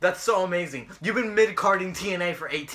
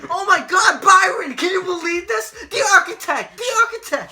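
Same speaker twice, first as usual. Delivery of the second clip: high, loud mock play-by-play shouting; hammed-up screaming hype; frantic commentator parody; 11 semitones higher, 7 dB louder than usual. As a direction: lose your mind like a wrestling announcer. Parody of overexcited ringside commentator.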